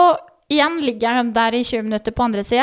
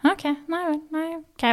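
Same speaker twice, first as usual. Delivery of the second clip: much softer, higher, frightened; small scared reply; 4 semitones higher, 8 dB quieter than usual. Retold or imitated. Frightened mother accepting the midwife's reassurance, hushed. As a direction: answer meekly, scared.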